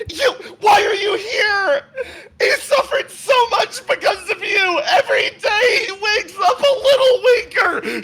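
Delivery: high, loud, tearful shouting; crying yell. Acted. Woman screaming through tears at the man she blames. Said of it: "she's yelling".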